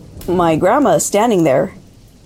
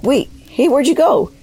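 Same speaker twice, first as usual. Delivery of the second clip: much higher-pitched retold call of surprise; puzzled calling after; 10 semitones higher than usual. Dad finding the figure gone, calling out puzzled. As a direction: call after someone who has just vanished, pitched high.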